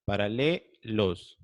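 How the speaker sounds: "paralelos" slowly, pausing before final "los"; sílabas separadas. Shown as a demonstration. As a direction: stretch out "paralelos" to show its ending. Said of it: "A ver, mira, digo". ("paralelos" lento y separado por sílabas)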